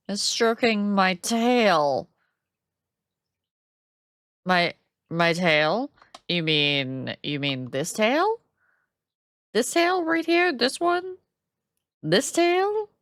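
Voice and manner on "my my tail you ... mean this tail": usual speaking voice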